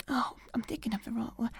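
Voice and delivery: quick whispered self-correction; muttered aside. Acted. Friend searching for a word, quick whispered aside. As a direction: mutter it to yourself, fast.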